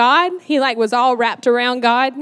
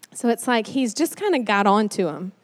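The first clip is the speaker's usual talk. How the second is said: normal talking voice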